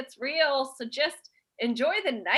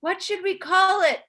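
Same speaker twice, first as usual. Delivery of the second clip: high, drawn-out sweet address to grandma; eager winemaker's voice; 7 semitones higher than usual. Acted. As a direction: ask Nona in a put-on high voice. Voicing someone asking old Nona.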